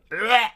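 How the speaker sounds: high pained retch; gagging sound. Retold or imitated. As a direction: imitate a stifled gag. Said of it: "saw you looking pained and going".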